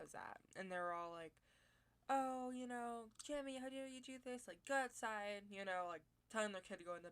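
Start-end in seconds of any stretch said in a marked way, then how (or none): 2.1-5.5 mock parental yelling. Family telling off their kid over the fence, mock yelling. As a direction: mimic an exasperated parent, only half yelling. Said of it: "yelling at their kid".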